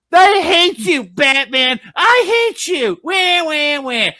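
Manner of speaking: put-on high, loud shouting kid; whiny child tantrum. Robin punching Batman, a high bratty tantrum. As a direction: wail it out like a bratty boy hitting his hero.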